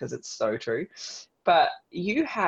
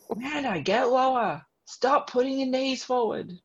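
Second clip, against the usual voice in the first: high-pitched mock trainer barking orders; bossy trainer; 10 semitones higher, slower than usual. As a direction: play the drill-sergeant coach.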